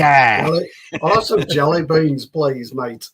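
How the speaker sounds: mock-Scottish that slips into northern English, starting loud; attempted Scottish accent. Shown as a demonstration. Friend joining in the jelly bean bit, botched Scottish accent. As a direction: answer in a rough northern-sounding Scottish accent. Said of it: "That's more north".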